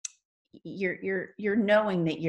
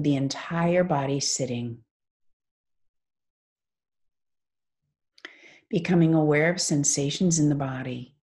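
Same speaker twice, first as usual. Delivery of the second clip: guided meditation. Acted. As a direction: ease them into stillness, phrase by unhurried phrase.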